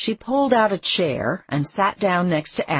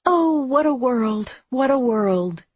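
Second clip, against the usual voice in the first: wailing witch quote; 9 semitones higher, 5 dB louder than usual. A narrator's reading. Abby quotes the Wicked Witch in the kitchen, wailing theatrically. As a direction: draw it out like a melodramatic dying witch. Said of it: "The witch says".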